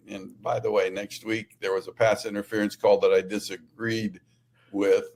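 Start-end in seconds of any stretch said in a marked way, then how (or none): none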